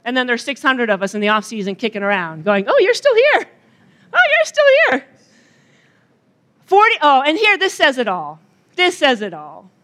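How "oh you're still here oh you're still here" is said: high, bored small-town greeting; sing-song mock of locals spotting each other; mock townsfolk greeting. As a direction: drone it like a neighbour who's seen you all winter.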